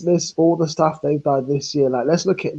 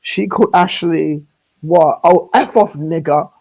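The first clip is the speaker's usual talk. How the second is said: mimicking her; 3 semitones higher, 4 dB louder than usual. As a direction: mimic her sneering insult. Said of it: "the way she said it was".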